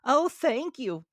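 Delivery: high, slow mock-tearful grasshopper; a hint of tears in the grasshopper's thanks; lightly teary high insect voice; tearfully. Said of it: "said Grasshopper tearfully".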